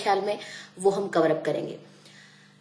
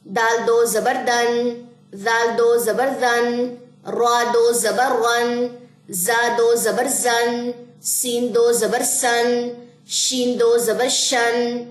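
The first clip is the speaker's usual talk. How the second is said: slow recitation; much slower than usual. Shown as a demonstration. Teacher drills the next line for students, slow. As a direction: read each letter slowly for students. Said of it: "قاعدہ drill".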